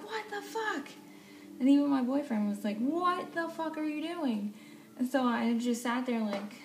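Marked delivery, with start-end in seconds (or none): 0.0-0.9 high-pitched incredulous exclamation; mock-outraged high voice; retold shocked outcry. Retells her shock at driver. 2.8-4.5 high, slow, drawn-out disbelief; mock outrage, pitched up; imitated outraged boyfriend. Acts out boyfriend scolding driver.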